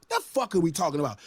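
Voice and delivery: ordinary talk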